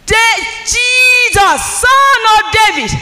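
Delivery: slow, high, loud shout for mercy; blind man's shout. Retold or imitated. Preacher acting out the blind man crying for mercy, high and loud. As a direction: stretch it out and cry to him. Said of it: "And he shouted".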